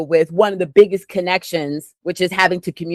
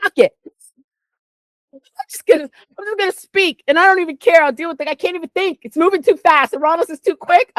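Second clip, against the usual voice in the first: much higher, rushing, letting it all out; venting about feeling overwhelmed by the stars; overwhelmed rant; 10 semitones higher than usual. Real, not acted. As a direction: spill it out fast and high as if overloaded. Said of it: "I just wanna let it all out".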